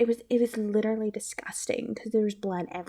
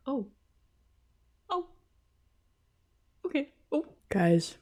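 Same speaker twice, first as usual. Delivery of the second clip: ordinary delivery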